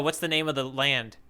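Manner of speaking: normal talking voice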